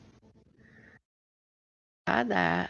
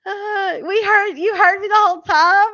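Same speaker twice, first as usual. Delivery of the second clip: high-pitched, amused disbelief breaking out of laughter; laughing disbelief; 10 semitones higher, 7 dB louder than usual. Real, not acted. Streamer learns viewers heard all the cussing, laughing disbelief. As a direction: ask it high, still cracking up.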